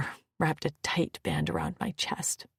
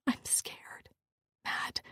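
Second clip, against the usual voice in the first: breathless, very soft whispered confession; panting whisper; 10 dB quieter, slower than usual. Acted. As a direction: barely breathe the words out. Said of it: "I whispered through panting breaths".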